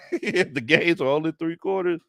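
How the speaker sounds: words broken by laughter; laughing. Real, not acted. Host cracking up at a viewer's joke, laughing through it.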